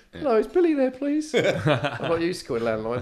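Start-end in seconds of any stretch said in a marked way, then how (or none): 0.1-1.3 high, nervous and laughing; a much higher voice, timid on the phone call. Retold or imitated. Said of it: "it's really nervous when you call him".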